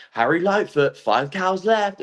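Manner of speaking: high-pitched commentator call, stretched at the end; event commentator. Retold or imitated. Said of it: "the commentator goes".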